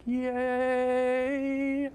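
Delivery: squealed high yay; a giddy cheer of delight; excited yay. Real, not acted. Excited cheer about graph theory.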